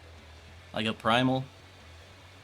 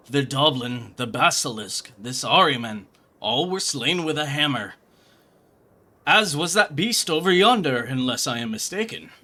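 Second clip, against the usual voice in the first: louder, grandiose gentleman detective voice; pompous inspector; 3 semitones higher, 7 dB louder than usual. Acted. Hildebrand greets Gilgamesh and plans the search, pompous and loud. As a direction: puff up like a self-important sleuth.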